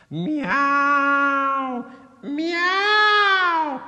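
very high, loud drawn-out meow; cat's meow. A narrator's reading. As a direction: pipe up with a long, piercing miaow. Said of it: "piped up old Tom".

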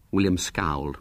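ordinary delivery

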